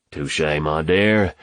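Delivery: ordinary delivery, nothing marked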